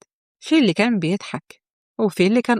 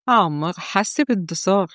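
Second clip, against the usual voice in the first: ordinary delivery, nothing marked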